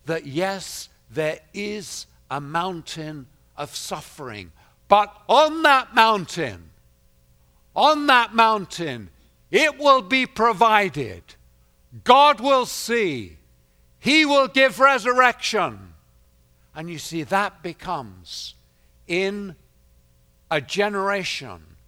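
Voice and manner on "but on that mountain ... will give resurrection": loud, high, ringing sermon voice; rising pulpit proclamation; full-force preaching. Acted. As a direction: preach it out to the whole hall.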